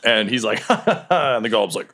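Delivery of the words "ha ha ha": higher-pitched acted ha-ha; high, staged chuckle; Greenbeard's laugh. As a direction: laugh in a raised character voice.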